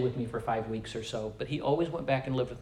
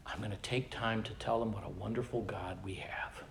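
whisper; 5 semitones lower, 12 dB quieter than usual. Real, not acted. Telling grandchildren about God.

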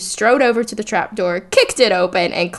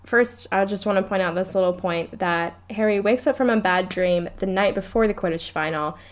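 usual speaking voice

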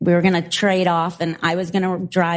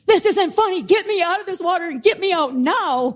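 high-pitched mock panic; exasperated, high-pitched pleading, acted out; retold freak-out; 8 semitones higher than usual. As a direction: replay an indignant, frantic demand to be rescued. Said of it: "like, freaking out".

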